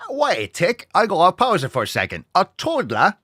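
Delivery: chirpy put-on Cockney, high; British accent, jokey and high. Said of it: "I love accents".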